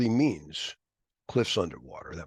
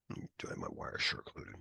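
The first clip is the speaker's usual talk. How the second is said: quick whispered aside to himself; 15 dB quieter, faster than usual. Real, not acted. Lecturer setting up software, whispering to himself. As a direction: hush it under your breath, fast.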